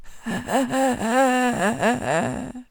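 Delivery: strange, drawn-out staccato chuckle; eerie storybook old man's laugh. A narrator's reading. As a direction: laugh oddly in a long, pulsing run. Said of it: "a strange kind of laugh".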